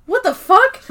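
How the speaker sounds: high, loud incredulous question; shocked disbelief. Retold or imitated. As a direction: voice leaps up in mock shock. Acting out Danny's shock at trick.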